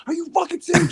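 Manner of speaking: high, loud incredulous shout; outraged disbelief. Retold or imitated. Comic shouting at the host who invites him back. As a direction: blurt it out in disbelief.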